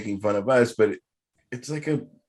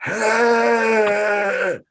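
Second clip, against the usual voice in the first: high, loud screeching karaoke wail; bad karaoke screech; 9 semitones higher, 4 dB louder than usual. Mocking own Bon Jovi karaoke, a shrieking wail. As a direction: belt a tuneless karaoke wail. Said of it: "what everyone else is hearing is".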